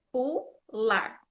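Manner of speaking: pular in two separated syllables, R sounded; R final pronunciado. Shown as a demonstration. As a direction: read PU, then LAR with a clear final R. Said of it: "ele tem som de er". ("pular" silabado, com o R final claro)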